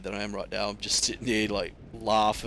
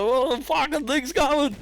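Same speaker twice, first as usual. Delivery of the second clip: panicked shriek; 6 dB louder than usual. Retold or imitated. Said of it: "I was like".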